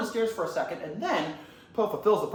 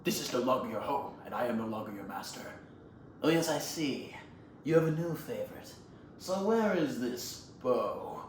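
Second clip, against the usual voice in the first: put-on quiet villain confronting his old master; Tai Lung; 6 semitones lower, 8 dB quieter than usual. Villain returns to face his old master, quietly menacing. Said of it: "Tylon goes".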